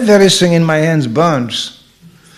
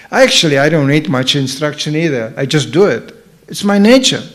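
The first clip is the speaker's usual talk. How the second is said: normal talking voice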